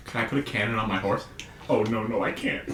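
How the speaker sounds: soft, low muffled voice; quiet. Retold or imitated. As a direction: say it softly as if overheard far off. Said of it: "He goes".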